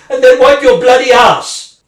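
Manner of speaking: loud furious shout; bursting into a furious shout; angry shout. Acted. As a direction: yell it at him. Angry tirade at husband.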